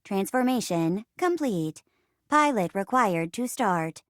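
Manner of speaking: measured machine status report; robot assistant. Acted. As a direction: announce status like a ship's computer. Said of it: "I'm your robot assistant".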